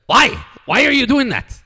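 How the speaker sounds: high, loud exasperated father; angry dad impression. Imitating a dad over soda on the floor, loud and angry. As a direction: demand it like a dad at the end of his rope. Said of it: "I gotta do my impersonation".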